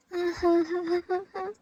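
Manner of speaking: slow drawn-out sob; sobbing. Acted. Jungkook breaking down crying in his hyung's arms, sobbing. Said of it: "Jungkook रोने लगता है".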